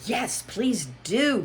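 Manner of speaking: pleading. Acted. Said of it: "pleaded Alice".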